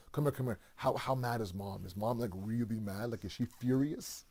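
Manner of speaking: put-on softly, a worried kid asking how mad mom is; a child's anxious low-voiced check; nervous hushed question. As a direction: keep it down so the parent won't hear. Child asks sibling about angry mom.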